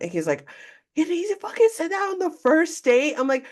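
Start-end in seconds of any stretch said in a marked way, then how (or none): 1.0-3.2 high, startled voice of the friend; shocked friend. Retold or imitated. The first guy reacting on the phone, shocked and high. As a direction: voice him gasping in disbelief.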